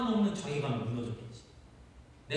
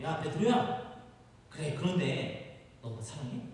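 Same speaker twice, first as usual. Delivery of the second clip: slow questioning; 3 dB quieter, much slower than usual. Acted. Jesus asks second time.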